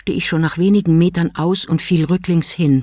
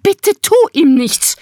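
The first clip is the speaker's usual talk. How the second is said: high, loud, whining plea; whimpering plea; 12 semitones higher, 6 dB louder than usual. A narrator's reading. Wife pleading with husband to spare the burglar, whining. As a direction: whimper it, much higher. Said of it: "winselte sie".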